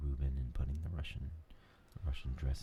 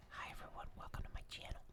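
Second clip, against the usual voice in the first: much softer airy ASMR whisper; Morpheus soft whisper; 12 dB quieter, faster than usual. Retold or imitated. Morpheus greeting his viewers, soothing soft whisper. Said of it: "he's up there going".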